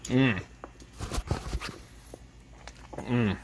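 satisfied hum, mouth full; savoring mm; approving mm while tasting. Real, not acted. Tasting the taco.

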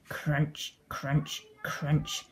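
low, soft, separate crunches; crunching footsteps. Acted. Tom's feet in the sand, soft low crunching. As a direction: imitate slow steps in sand. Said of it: "goes Tom".

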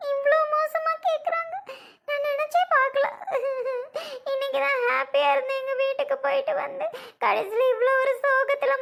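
very high, tearful wail; crying. Acted. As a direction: lament through sobs, deeply hurt. Anju weeping, told not to cry. (விம்மி அழுதுகிட்டே புலம்புவது)

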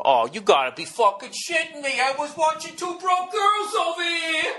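high squealing whine of disbelief; outraged TV viewer. Retold or imitated. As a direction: shriek like your show just cut out. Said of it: "they stand there and go".

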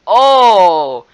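high, loud drawn-out "oh"; big reactive yell; loud exclamation. Real, not acted. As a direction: cry out as the hit lands. Reacts to getting pummeled.

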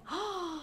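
a sharp little intake of breath, mock shocked; startled gasp. Retelling his cuddling question, a startled gasp. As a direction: gasp like the question caught her off guard.

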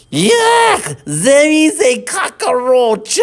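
high, slow disgusted shriek; scream. A narrator's reading. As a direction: shriek in horror at the bug. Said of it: "The French president's wife's scream".

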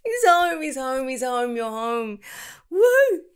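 ordinary talk, nothing marked

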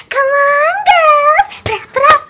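high, loud showgirl call; Adelaide impression. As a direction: yell at the girls shrilly. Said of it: "My best impression of Adeline".

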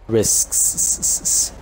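Risks with a long hissing final S; emphasised S. Shown as a demonstration. Gamer showing his habit, Risks with drawn-out S. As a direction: hold the S at the end of Risks. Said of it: "put an emphasis on the S".

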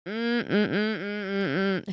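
mock-crying hums through closed lips; strained whimpering hums. Real, not acted. Streamer whimpers while holding his arms up. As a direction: whimper it like you can't hold on.

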